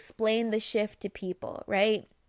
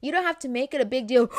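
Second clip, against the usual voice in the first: usual speaking voice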